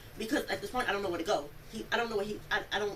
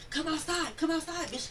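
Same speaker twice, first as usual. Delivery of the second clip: retold yelling; 7 semitones higher, slower than usual. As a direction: imitate him calling someone out. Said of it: "He's outside yelling out the window".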